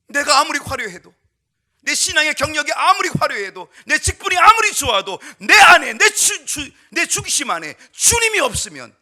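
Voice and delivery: loud, high rhetorical preaching; fervent preaching. Acted. Pastor challenging the church, loud fervent preaching. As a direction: hammer each question at the congregation.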